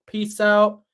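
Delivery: ordinary talk, nothing marked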